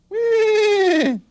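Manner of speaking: very high, loud neigh; horse whinny. Shown as a demonstration. Teacher showing how a horse goes, loud whinny. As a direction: squeal out a quavering horse whinny. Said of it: "And a horse goes".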